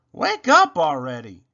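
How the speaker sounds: loud impatient shout. A narrator's reading. Rabbit shouting at sleeping Robot, loud. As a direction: yell it to rouse him. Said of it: "shouted Rabbit".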